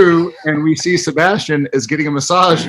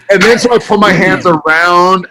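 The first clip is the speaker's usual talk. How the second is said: normal talking voice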